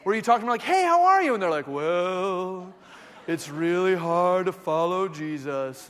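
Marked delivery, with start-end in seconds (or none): 0.6-1.3 high, chirpy hello; upbeat mock small talk; acted cheery greeting. Cheery greeting to gloomy person. 1.7-5.9 slow, low, gloomy drone; glum, sighing delivery; Eeyore. Retold or imitated.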